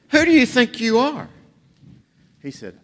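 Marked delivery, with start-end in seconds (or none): 0.1-1.3 higher-pitched, mock-indignant question; incredulous challenge. Burglar challenging a talking parrot, incredulous.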